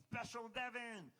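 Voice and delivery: high, slow put-on alter-ego voice; Special Devin voice. Comic naming his inner self, high and drawn out. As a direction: pitch it way up and stretch it like a goofy inner self. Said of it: "special inner part of me".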